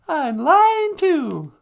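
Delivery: high, slow wifely call. Acted. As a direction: sing it out slowly as the wife. Wife voice, slow.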